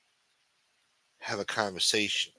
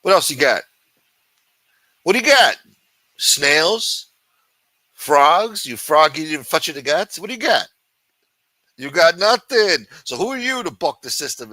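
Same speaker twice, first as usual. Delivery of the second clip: loud, high mocking taunt, louder and higher; taunting shout; 6 semitones higher, 9 dB louder than usual. Streamer yelling taunts at Macron over French goods, loud mocking shout. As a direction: yell the dare at him like a bully.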